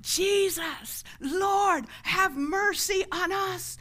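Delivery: high, loud drawn-out plea. Retold or imitated. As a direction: shout over a crowd for mercy. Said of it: "they cried all the louder".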